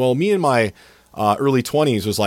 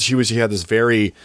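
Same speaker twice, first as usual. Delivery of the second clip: ordinary talk, nothing marked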